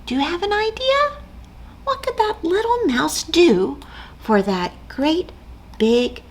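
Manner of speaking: high curious asking; curious question. Acted. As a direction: ask the kids eagerly. Asking children.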